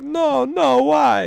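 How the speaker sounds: high, loud, whining no; mock wailing plea. Boyfriend begging why she hates him, loud high mock wail. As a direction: wail it like a heartbroken lover, hammed up.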